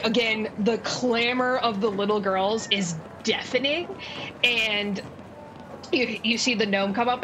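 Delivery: normal talking voice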